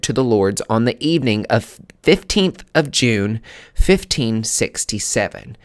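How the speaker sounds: loud, dramatic read-aloud with weighted pauses; reading a placard aloud. Acted. Reading viewers the final placard item, dramatic read-aloud. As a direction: build suspense as you read it out.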